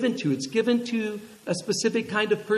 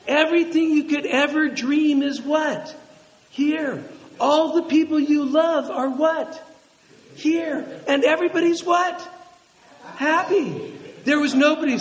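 higher, louder, rising fervor; exultant preaching; 7 semitones higher, 5 dB louder than usual. Real, not acted. Pastor rousing the congregation about heaven, loud and exultant. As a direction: preach it out with joy.

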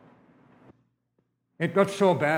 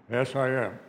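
Esau; 2 semitones lower than usual. Acted. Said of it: "he didn't like my voice".

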